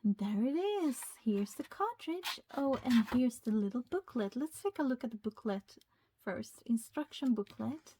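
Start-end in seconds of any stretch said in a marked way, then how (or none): none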